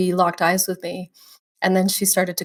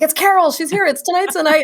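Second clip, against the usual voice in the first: high, mostly louder mock-frantic excitement; excited cast chatter; 9 semitones higher, 6 dB louder than usual. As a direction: imitate the whole cast buzzing that the star is here. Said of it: "everybody's like".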